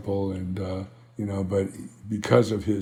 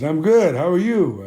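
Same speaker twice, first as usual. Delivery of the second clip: upbeat reply; 7 semitones higher, 5 dB louder than usual. Retold or imitated. Retold friendly reply on phone.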